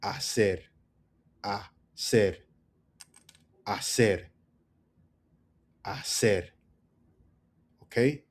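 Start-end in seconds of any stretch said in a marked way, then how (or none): 0.0-6.4 Hacer said ah-SEHR; silent H. Shown as a demonstration. Teacher drilling the word, "Hacer" with silent H. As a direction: lean on ser in "Hacer", no H. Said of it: "Emphasis on the ser".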